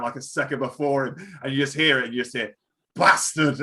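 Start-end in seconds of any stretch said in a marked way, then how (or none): 3.0-3.6 loud, higher yell at a rival; mock shouted curse. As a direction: re-enact yelling at the man who just beat you. Re-enacts shout heard on competition video.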